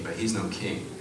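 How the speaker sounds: ordinary talk, nothing marked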